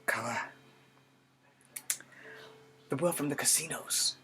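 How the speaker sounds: put-on measured, earnest young soldier with long pauses between phrases; Charlie Iron Knife. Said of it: "I'm going to be a character from G I Joe named Charlie Iron Knife".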